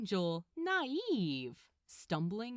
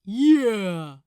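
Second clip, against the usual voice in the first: loud agreement; 17 dB louder than usual. Acted. Demon backs up threat.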